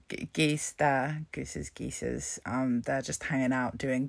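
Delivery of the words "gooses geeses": silly low mutter. Real, not acted. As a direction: drop low and mumble the silly plurals. Joking plural of goose.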